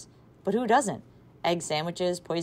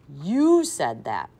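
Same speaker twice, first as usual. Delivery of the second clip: slow, louder, pointed; snide accusation; 7 dB louder, much slower than usual. A narrator's reading. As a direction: lean on each word to pin it on her. Imogene turning it back on Maxine.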